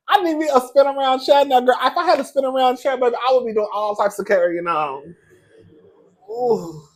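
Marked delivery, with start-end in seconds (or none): 0.1-5.1 very high, loud gushing squeal; excited squeal. Real, not acted. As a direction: squeal it out with delight. Squealing about wanting a chair.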